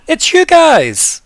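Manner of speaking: high, loud, drawn-out cry of welcome; delighted greeting. Acted. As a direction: greet old friends at full volume, stretched out. Smiling camper greets everyone.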